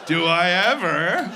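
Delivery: hammed-up eager clerk; delighted shop clerk. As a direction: gush like a smitten employee. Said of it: "They're like".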